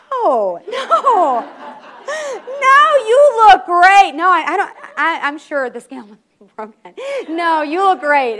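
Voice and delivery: high, loud, panicked backpedaling with a nervous laugh; flustered husband. Acted. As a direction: caught out, he insists she looks great. Husband scrambles to reassure wife.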